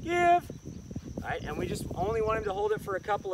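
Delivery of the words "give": high sharp command. Real, not acted. Trainer commanding dog to release.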